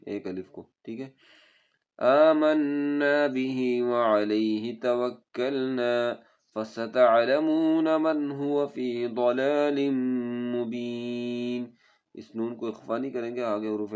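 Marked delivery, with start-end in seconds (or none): 2.0-11.7 melodic chanted tajweed recitation; Quran recitation. Shown as a demonstration. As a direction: recite slowly and musically, stretching vowels.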